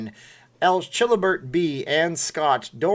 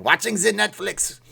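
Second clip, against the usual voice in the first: higher-pitched mock German accent; 6 semitones higher than usual. Shown as a demonstration. Streamer replays his accent slip, mock German accent. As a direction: repeat it in an exaggerated German accent. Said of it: "I went from Austrian to German".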